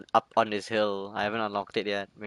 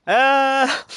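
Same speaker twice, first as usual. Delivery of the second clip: sudden high yelp; startled yelp; 10 dB louder than usual. Real, not acted. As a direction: yelp in surprise. Startled in game.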